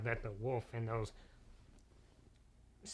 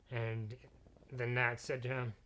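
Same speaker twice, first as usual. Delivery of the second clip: plain reading voice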